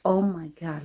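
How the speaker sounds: normal talking voice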